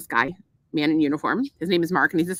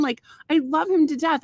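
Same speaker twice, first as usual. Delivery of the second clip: ordinary talk, nothing marked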